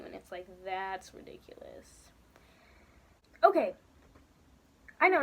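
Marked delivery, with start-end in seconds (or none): 0.0-2.1 whispered exasperated aside. Real, not acted. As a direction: mutter it under your breath in a whisper.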